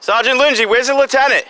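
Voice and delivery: high-pitched call across a distance. Real, not acted. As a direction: call after someone who is leaving. Calling out to a departing officer.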